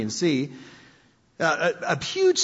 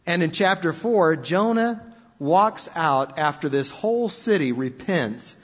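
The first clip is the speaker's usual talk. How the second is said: usual speaking voice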